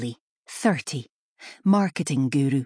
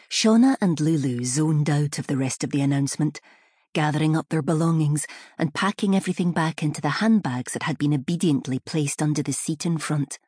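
ordinary delivery, nothing marked

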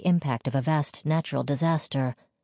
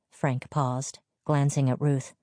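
usual speaking voice

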